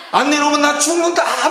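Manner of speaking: high-pitched, hammed-up child ultimatum; mock child tantrum. Preacher imitating a child threatening parents for a toy, mock tantrum. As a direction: act out a bratty high wail of a threat. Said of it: "가다가 뒤집어져가지고".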